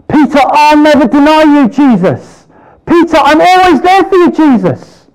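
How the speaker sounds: high, loud, eager vow of loyalty; boastful Peter. Acted. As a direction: swear it like a proud disciple who overrates himself.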